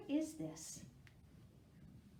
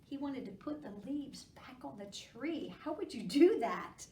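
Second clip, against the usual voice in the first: ordinary delivery, nothing marked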